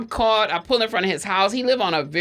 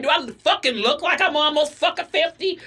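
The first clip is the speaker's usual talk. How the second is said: high-pitched indignant mock outburst; mock offended rant, pitched up; imitating daughter cussing; 8 semitones higher than usual. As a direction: act out a daughter telling off officers. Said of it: "So she's cussing their ass out".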